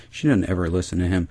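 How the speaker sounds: ordinary talk, nothing marked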